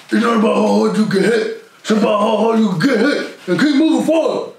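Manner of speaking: Sylvester Stallone with jaw jutting out; Rocky voice, jaw stuck out dumb; underbite. Retold or imitated. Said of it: "Why you gotta go with the dummy lip?".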